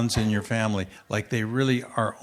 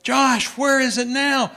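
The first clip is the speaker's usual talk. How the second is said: higher, louder and slow worried dad; father asking his son where the shoe is now; alarmed dad; 11 semitones higher, 7 dB louder, slower than usual. Retold or imitated. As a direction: demand it like a startled parent.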